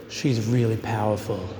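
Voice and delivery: light Australian twang on "She's really powerful"; a hint of an Aussie friend's voice; lightly imitated Australian accent. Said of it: "his Australian accent".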